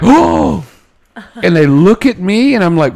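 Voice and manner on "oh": imitated surprise. Whole salon reacts.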